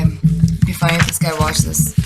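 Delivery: ordinary talk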